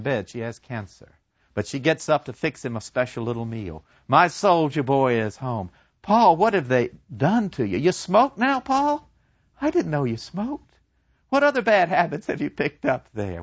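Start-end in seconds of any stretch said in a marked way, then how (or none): 4.1-13.4 high, fluttery mother voice, pitch climbing on "Paul?"; a mother fretting over her soldier son; doting mother. Acted. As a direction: play the worried, fussing mother. Mother fussing over returned son.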